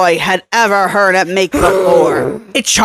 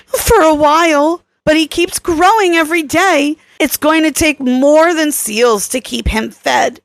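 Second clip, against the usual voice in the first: put-on higher, insistent young woman arguing, faster at the start; Kelly, urgent; 8 semitones higher than usual. A narrator's reading. Kelly lecturing Tarosh about the predator's nature, urgent. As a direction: press the point hard and quick.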